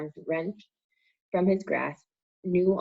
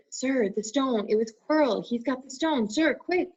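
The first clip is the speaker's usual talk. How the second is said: urgent; 8 semitones higher than usual. A narrator's reading. Harry urgently alerting Dumbledore.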